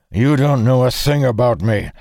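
heated, forceful denial; angry retort. A narrator's reading. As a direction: snap back in fury. Angry retort mid-fight.

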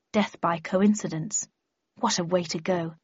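plain reading voice

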